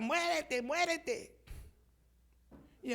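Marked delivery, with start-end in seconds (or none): 0.0-1.4 high, taunting frog chorus, the last word loud; mock the frog in the hole; taunting from above; jeering frogs. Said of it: "las otras gritaban".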